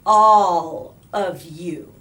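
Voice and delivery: a teacher's pointed look in words; slow pointed "All of you"; pointed warning. A narrator's reading. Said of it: "She glanced at Eddie".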